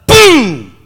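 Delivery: sudden imitated engine bang, loud and high; explosive mock boom. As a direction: blast out the crash noise. Van engine blows while driving.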